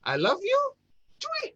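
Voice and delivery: very high, jokey love line; playful, insincere declaration. Shown as a demonstration. Coach replaying the third example for listeners, high and playful. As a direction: pitch it way up, cheeky and unserious. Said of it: "Yang ketiga tadi".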